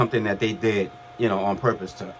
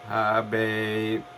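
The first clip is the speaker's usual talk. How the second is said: groggy voice. Retold or imitated. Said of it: "I said it in my, in, in a groggy voice".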